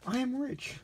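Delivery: high, thrilled exclamation; a boy crying out at sudden wealth; delighted cry. Acted. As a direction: burst out with joy. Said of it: "Lexington cried".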